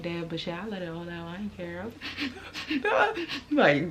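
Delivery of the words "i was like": slow laugh through the words. Real, not acted. Laughing while telling crying story.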